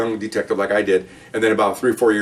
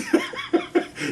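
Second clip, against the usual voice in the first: retold chuckle; 4 dB quieter than usual. Undercover officer laughs it off.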